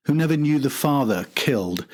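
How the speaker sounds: usual speaking voice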